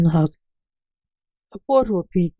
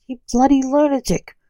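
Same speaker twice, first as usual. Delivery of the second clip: raised, higher indignant exclamation; exasperated outburst; 7 semitones higher, 4 dB louder than usual. A narrator's reading. Simon to the enchanter who just turned up, exasperated. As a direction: snap it out in exasperation.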